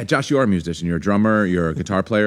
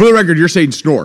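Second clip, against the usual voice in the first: high, loud teasing over laughter; gleeful raised-voice ribbing; 8 semitones higher, 8 dB louder than usual. Real, not acted. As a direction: announce it loudly for the record, mocking. Teasing her accent amid laughter.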